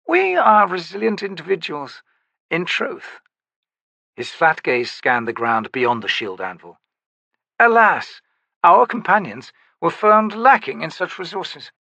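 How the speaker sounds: high, smooth, courtly voice; coolly understate a slaughter; a lightly voiced urbane nobleman; urbane sorcerer. Beauchelain speaks smoothly.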